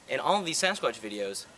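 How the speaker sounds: ordinary talk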